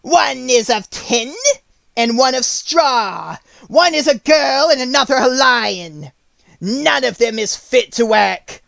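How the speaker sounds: high, slow, wicked witch voice. Acted. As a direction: sneer it like a cruel witch. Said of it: "she answered".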